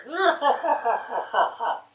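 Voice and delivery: high, loud hammed-up wail-laugh; a loud high mock sob turning into laughter; fake cry laugh. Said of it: "I didn't cry very loud".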